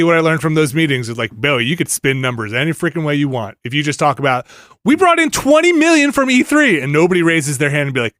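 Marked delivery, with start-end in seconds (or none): 4.8-6.8 high-pitched hype-up voice, lightly mocking; mock boast. Boasting to the people upstairs about E three money, mock boast. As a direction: pitch it up like a big announcement. Mimics executives boasting in meetings.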